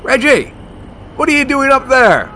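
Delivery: calling to a friend dangling from the roof. Acted. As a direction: shout up to him. Calling to man hanging.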